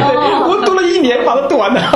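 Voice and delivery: real laughter recalling a feat; laughing. Real, not acted. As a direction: laugh it out slowly. Laughing, recalling reading whole Bible.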